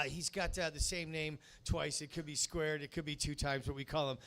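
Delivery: usual speaking voice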